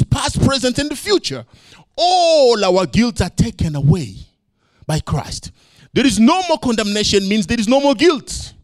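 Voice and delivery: fervent sermon delivery; preaching. Real, not acted. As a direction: preach it out to the church. Preaching at full force.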